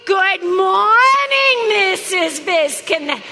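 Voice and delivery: loud, slow, drawn-out greeting. Shown as a demonstration. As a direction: belt the greeting slowly in her own voice. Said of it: "I want it in mine, all right?".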